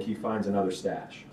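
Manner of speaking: usual speaking voice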